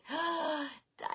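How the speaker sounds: a quick delighted intake of breath; surprised gasp. Real, not acted. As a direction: gasp on spotting a friend in the chat. Sees viewer Diane's comment.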